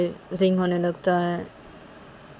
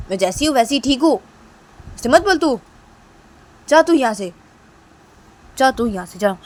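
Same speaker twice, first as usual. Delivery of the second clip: high, loud angry dismissal; 5 semitones higher, 6 dB louder than usual. Acted. Sister sending her brother out of the room. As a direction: order him out sharply.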